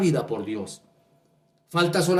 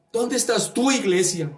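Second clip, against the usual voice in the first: challenging rebuke; 7 semitones higher than usual. Acted. Preacher challenging the church.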